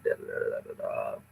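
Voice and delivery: very soft, absent-minded da-da filler; muttered placeholder. Real, not acted. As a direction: murmur under the breath while scanning. Skimming the questions while searching.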